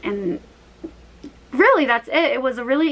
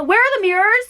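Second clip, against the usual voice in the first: a higher, confused, helpless repeat of the question; flustered, higher-pitched; 10 semitones higher, 3 dB louder than usual. Retold or imitated. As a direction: pass the question on in a flustered panic. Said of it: "I just started and I didn't know what to do".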